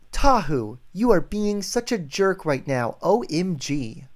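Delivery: playfully indignant line reading; demonstrated annoyed line. Shown as a demonstration. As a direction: act out a fed-up complaint. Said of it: "Can you say that exactly?".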